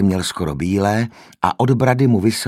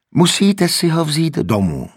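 much higher, louder firm insistence; insistent nurse; 10 semitones higher, 5 dB louder than usual. A narrator's reading. The nurse insists Mr Button take him home. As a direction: hold your ground. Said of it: "trvala sestra na svém".

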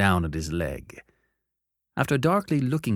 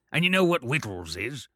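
gruff convict; 4 semitones higher than usual. Acted. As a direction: growl the question. Convict asks.

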